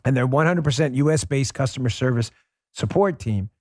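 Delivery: host reading a paid ad to his audience. Acted. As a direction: sell the product to the listeners. Reads sponsor ad.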